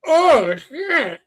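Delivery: high, loud oh shit; startled. Retold or imitated. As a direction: blurt it in shock. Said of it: "I'm like".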